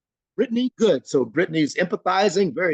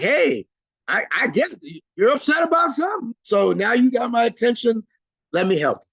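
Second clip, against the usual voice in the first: lightly acted agreement, higher-pitched; higher-pitched role-played "I get it"; playing along agreeably; 6 semitones higher than usual. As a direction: play along with an upset client, pitch lifted. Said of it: "kind of playing the role".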